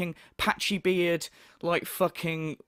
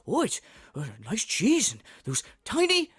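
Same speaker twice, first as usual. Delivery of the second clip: mock excitement over food; hammed-up foodie rapture; Phil impression; 4 semitones higher than usual. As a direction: imitate a streamer gushing, climbing higher as it builds. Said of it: "I love how excited he gets".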